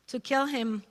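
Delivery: ordinary talk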